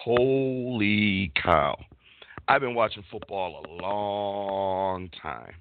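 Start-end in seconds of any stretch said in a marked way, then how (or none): 0.0-1.8 very slow, stretched-out exclamation; amazed drawl. Real, not acted. Host opening the show in amazement, very drawn out. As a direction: stretch both words out in awe.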